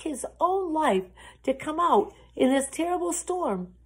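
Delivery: put-on dramatic questions to young listeners; worried story narration. A narrator's reading. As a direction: fret aloud for the lost lamb. Tells story to children.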